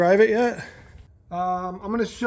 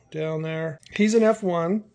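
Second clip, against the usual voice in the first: ordinary delivery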